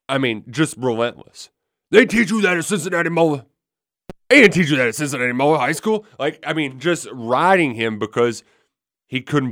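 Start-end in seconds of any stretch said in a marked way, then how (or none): 1.9-6.0 high, loud mocking coach jab; needling coach. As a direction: rib him like a tough position coach. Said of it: "Vince Marrow just busting his balls".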